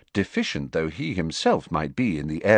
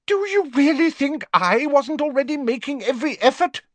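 high, indignant demand; heated; 4 dB louder than usual. Acted. Fudge rounds on the Prime Minister, heated and high. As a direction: fling the question back hotly. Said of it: "demanded Fudge heatedly".

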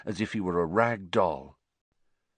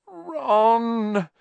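a higher, drawn-out call of distress; moaned; 13 semitones higher, 4 dB louder than usual. A narrator's reading. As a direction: cry after him in despair. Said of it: "Hermione moaned".

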